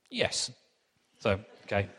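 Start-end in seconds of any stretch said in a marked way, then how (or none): 0.1-0.5 high, falling yes in a mock German accent; one-word answer as a comic German; comedy German accent. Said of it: "And he says".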